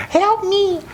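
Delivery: tiny squeaky cry for help; fluttering cries for help. Acted. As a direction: squeal it like a trapped fly-man. Imitating the fly from The Fly.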